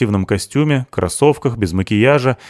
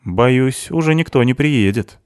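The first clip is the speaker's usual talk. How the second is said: ordinary delivery, nothing marked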